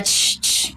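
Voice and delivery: retold hushing "ch, ch", breathy and unvoiced; imitated demonic shush; possessed shushing. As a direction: shush them hard under your breath, like something inhuman. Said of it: "Nos decía".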